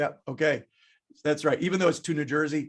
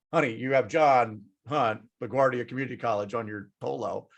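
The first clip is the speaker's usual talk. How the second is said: lower-pitched, dry explanation; imitated deadpan wife; 5 semitones lower than usual. Wife points out husband's shirt, lower deadpan imitation. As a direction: play the wife setting him straight, voice dropped.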